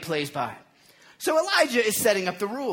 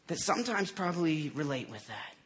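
ordinary talk, nothing marked

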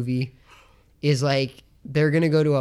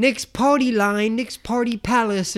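high, drawn-out fake British voice; bad British accent; 7 semitones higher, slower than usual. Guy answering the phone at Nick's party, bad British accent. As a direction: ham up a terrible British accent, slowly. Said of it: "super shitty British accent".

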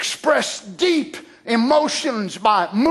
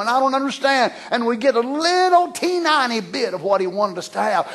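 ordinary delivery

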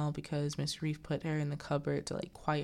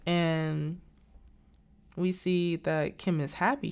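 ordinary delivery, nothing marked

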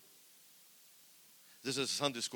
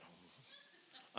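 scared gasp; 21 dB quieter than usual. Retold or imitated. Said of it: "I'm saying".

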